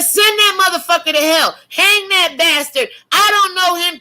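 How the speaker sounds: high, loud shouting denial; Peter's furious denial. Retold or imitated. Peter shouting to the crowd that he doesn't know Jesus, high and loud. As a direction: yell it like a man disowning a friend.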